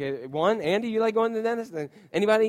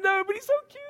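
put-on much higher pleading kid voice; whiny little boy; faster than usual. Pastor imitates his son pleading to his dad, much higher. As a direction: whine it like a small child. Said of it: "He said".

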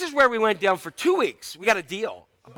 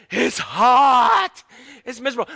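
mock whining kids, louder and higher; whiny kids; 4 semitones higher, 6 dB louder, slower than usual. Kids complain at hot ruins.

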